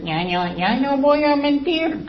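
put-on high, slow baby-talk promise; mock baby voice. Mimicking a baby to the church, slow high baby voice. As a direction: pretend a baby is vowing to stop lying.

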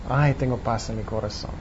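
soft, low, contented sigh; peaceful relief. Acted. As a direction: let it settle down softly into peace. Voices someone trusting God's love. (alivio tranquilo, suave y grave)

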